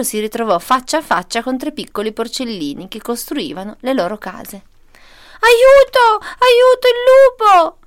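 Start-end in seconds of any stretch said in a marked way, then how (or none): 5.4-7.8 high, loud squeal for help; piglets' terrified scream. A narrator's reading. Three little piglets spot the wolf, high panicked scream. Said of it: "urlarono i porcellini".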